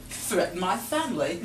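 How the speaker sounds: low, soft, matter-of-fact; deadpan husband. Retold or imitated. Husband calmly justifying killing the ram, low and soft. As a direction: say it flat and unbothered.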